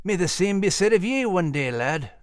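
put-on kindly Scottish old soldier; knowing old Scottish sergeant. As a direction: wish a lad well with a knowing lilt. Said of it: "with a knowing grin".

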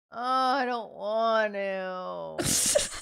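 very slow mock-tearful whine. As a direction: drag it out like a fake sob. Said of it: "I could say".

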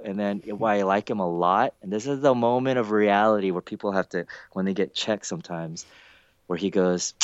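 normal talking voice

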